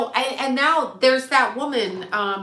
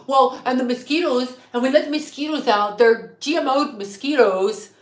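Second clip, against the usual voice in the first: lightly mocking, blathering imitation, pitched up a little; Bill Gates impression; 4 semitones higher than usual. Viewer told to watch him talk, mock blathering impression. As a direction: mimic a rambling public talker. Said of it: "Watch Bill Gates talk. He talks. He's like".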